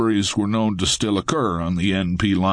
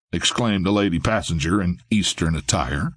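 ordinary delivery, nothing marked

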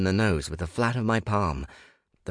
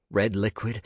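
plain reading voice

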